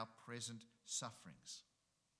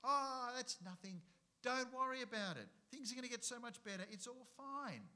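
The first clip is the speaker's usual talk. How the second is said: higher, airy, brushing the worry aside; mock breezy reassurance; 10 semitones higher, 5 dB louder than usual. God's word seeming to shrug off suffering, mock breezy. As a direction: toss it off like it's no big deal. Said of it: "it feels like God's word is saying".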